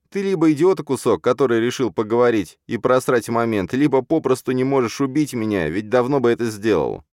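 high, cocky taunt. Acted. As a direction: needle him with contempt. Hero taunts the villain. (дерзко, с издёвкой)

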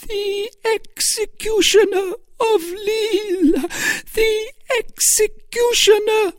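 very high, loud, slow shriek; Milady clinging to the wall in terror; terrified cry. A narrator's reading. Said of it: "a prey to insensate terror".

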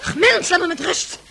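a put-on grand lady, brushing her off; irritated old lady voice. Old countess snapping at the nurse. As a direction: swat the nurse away in a haughty old voice.

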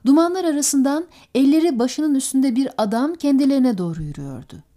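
ordinary delivery

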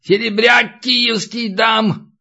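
high, drawn-out shout from below; yelled offer. A narrator's reading. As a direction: call up a bid, stretching the words. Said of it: "заорал Натаниэль". (протяжный выкрик снизу)

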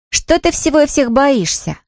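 high-pitched teasing sister; older sister. A narrator's reading. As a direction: taunt a younger brother, pitched high. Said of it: "спросила старшая сестра". (старшая сестра, высоким голосом)

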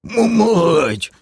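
high, pained moan of a curse; agonized groaning oath; groaned curse. A narrator's reading. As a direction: stretch the first sound, then curse through the pain. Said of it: "утробно застонал Крей".

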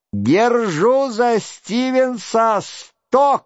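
high, drawn-out call of a bet; mock shout. A narrator's reading. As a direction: call the bet out high and slow, held back in volume. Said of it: "кричал один".

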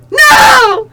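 high, loud melodramatic "no"; hammed-up shout of denial; dramatic cry. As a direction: wail it out in mock anguish. Reading melodramatic fan fiction aloud.

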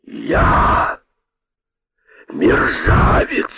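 slow, breath-heavy indignant repeat; speechless anger. A narrator's reading. Python repeating the rabbit's insult, slow speechless anger. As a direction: stretch each word out, choked with outrage. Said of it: "не находя слов от гнева".

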